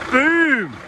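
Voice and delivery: high, loud triumphant Boom; excited shout. Real, not acted. Triumphant exclamation while unloading.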